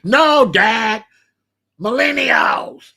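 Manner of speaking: high, loud exasperated correction; pissed daughter. Retold or imitated. Daughter correcting her confused dad, pissed. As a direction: imitate a daughter shouting the answer at Dad. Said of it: "My daughter was pissed".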